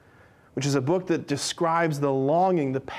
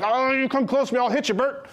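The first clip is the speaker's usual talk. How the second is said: defensive threat; 7 semitones higher, faster than usual. Retold or imitated. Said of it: "in this posture of like".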